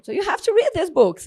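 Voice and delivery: a lightly voiced excited mentor; retold urging. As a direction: press her to read the books. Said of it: "she said".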